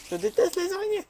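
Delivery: high, loud mock-frightened squeak; squeaky high voice. Squeaking to viewers about hated spiders. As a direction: squeal it like a scared cartoon.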